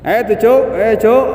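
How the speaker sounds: high, loud yell at a teammate; mock gamer shout. Teacher imitating a gamer yelling at teammates, loud. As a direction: shout at your squad mid-match.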